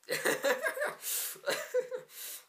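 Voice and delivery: high breathy burst of laughter. Real, not acted. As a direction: laugh it off, embarrassed. Laughs after apologizing.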